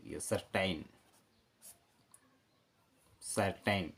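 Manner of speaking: सर्टेन said softly as the model; soft model word. Shown as a demonstration. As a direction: quietly give सर्टेन as the answer. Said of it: "यदि पूरी एकदम सही spelling को लिखना है तो".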